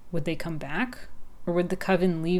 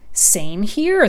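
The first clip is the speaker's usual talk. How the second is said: higher, louder eager agreement; exclaiming; 4 semitones higher, 9 dB louder than usual. Acted. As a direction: blurt it out in recognition. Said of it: "the crow exclaimed".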